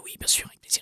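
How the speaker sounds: whispered reply, unvoiced. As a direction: whisper the teacher's answer.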